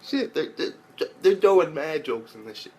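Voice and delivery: high, thin gasp of surprise, mostly unvoiced in the middle; hammed-up shocked delight, slowing down on the stammer; mock surprised squeal. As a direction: squeak it like you can't believe they went there. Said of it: "I'm like".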